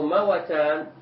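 "موتان" said mawatan, a on mim and waw; both vowels open. Shown as a demonstration. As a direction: give "موتان" three syllables, ma-wa-tan. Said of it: "بفتح الميم والواو".

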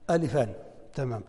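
ordinary delivery

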